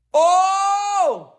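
high, drawn-out exclamation; thrilled cry. Real, not acted. Team just got ahead.

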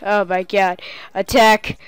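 ordinary delivery

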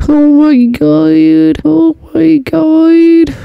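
very high, drawn-out squeal of fake horror; mock shock. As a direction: squeal it slowly, hammed-up and sky-high. Mock shock at conspiracy headline.

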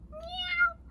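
high-pitched imitated meow; cat's meow. Reader greets as the uncle's cat, high meow. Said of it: "a su gato".

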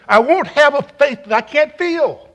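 mild imitation of a shouting country pastor; a touch of the old hooper's cry; country preacher shout. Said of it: "a stumper and a shouter".

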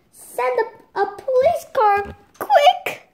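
high, loud urgent call; urgent yell. Acted. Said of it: "yelled Michael".

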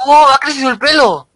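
high excited surprise; surprised. Real, not acted. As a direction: exclaim in delighted surprise. Notices character's hair grew.

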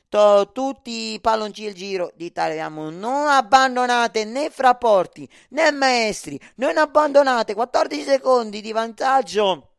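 loud, high appeal; excited pleading. Real, not acted. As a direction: beg them not to quit. Pleading with the crowd.

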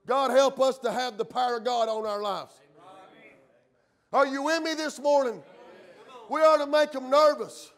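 forceful, ringing pulpit declaration; full-force preaching. Real, not acted. Preacher tells church he's not ashamed, full-force preaching. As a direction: preach it boldly and keep driving.

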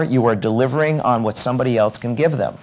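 normal talking voice

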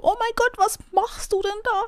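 very high, squeaky panic; squeaky squeal. Shown as a demonstration. Streamer demos how they will squeal during the fight, very high. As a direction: squeak it like a madman. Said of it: "rumquietschen wie ein Bekloppter".

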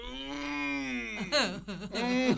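imitating a strained hum through a closed mouth; imitated mm of strain; straining grunt. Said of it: "y el tipo hacía".

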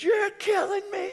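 high crying scream, mock pain; imitating screaming. Knee patient screaming at her therapist in pain. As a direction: wail it like she's being hurt. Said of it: "She's screaming, crying".

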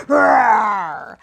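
loud, playful roar in the song; dinosaur roar. Acted. Host roars in the song for the children, loud and playful. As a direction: let out a big hammed-up roar. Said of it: "letting out a roar".

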